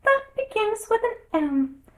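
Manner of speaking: high squeaky voice; just-woken Dormouse. A narrator's reading. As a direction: pick up the thread drowsily after a pinch. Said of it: "woke up again with a little shriek".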